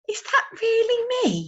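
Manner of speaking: high, loud wondering squeak; amazed owlet. Acted. Owlet amazed at his portrait. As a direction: stare at his picture.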